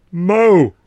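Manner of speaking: injured man grunts loud refusal; grunted no. Acted. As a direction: force it out through the pain. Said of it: "I grunted".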